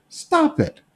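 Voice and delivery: high, sharp "Stop it"; a teacher halting his students; firm stop. Acted. Jesus rebuking apostles.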